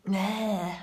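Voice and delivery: ordinary talk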